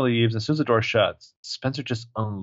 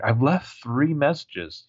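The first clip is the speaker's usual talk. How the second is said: slow exasperated; 4 semitones higher, much slower than usual. Retold or imitated. Hannah exasperated.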